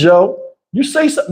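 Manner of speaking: usual speaking voice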